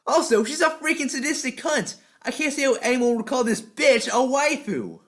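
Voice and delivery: high, loud, punchy review voice; animated anime-review narration; energetic script read. Acted. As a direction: hype up every list point.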